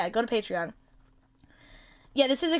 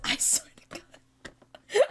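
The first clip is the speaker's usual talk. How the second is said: laughing whisper; 8 semitones higher, much slower than usual. Real, not acted.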